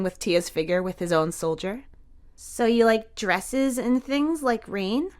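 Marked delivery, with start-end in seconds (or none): 2.4-5.1 put-on higher, slow child's question; a boy puzzling it out; curious little boy. A narrator's reading. Jamie asking Tia about dresses, slow and curious.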